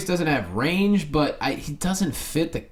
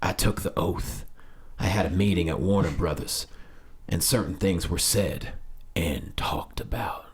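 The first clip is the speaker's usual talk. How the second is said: [name] handsome voice; 8 semitones lower, 5 dB quieter than usual. Acted. Reading the actor's interview quote to co-hosts, suave handsome voice. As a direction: put on a deep, hushed handsome-man voice. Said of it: "I'm gonna do it in my [name] handsome voice".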